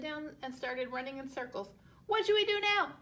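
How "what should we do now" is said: high, louder panicked holler. A narrator's reading. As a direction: holler it while running in circles. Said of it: "he hollered".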